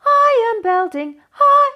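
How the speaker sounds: high, loud belt with breath; breathy belt. Shown as a demonstration. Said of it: "It was like".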